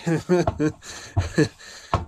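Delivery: grossed-out laughter; real chuckling fit; amused chuckle. Real, not acted. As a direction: laugh at how disgusting it is. Amused by the pureed fish.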